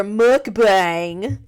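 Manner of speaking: jokey "mukbang", stretched out long and loud; exaggerated pronunciation. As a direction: draw out "mukbang" loudly in a hammed-up way. Jokey exaggerated repeat of the word.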